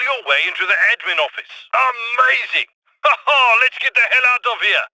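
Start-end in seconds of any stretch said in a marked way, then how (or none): 1.7-4.8 high, excited cheer with a laugh; rally the crew, voice raised in triumph; jubilant, high-pitched getaway call; elated. Acted. Crew leader celebrates, rallies the escape.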